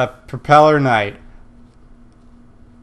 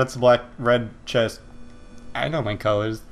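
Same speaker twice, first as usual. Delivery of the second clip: ordinary talk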